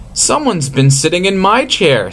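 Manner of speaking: a mother bear's voice, lifted up high; Mama Bear. A narrator's reading. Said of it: "said Mama Bear".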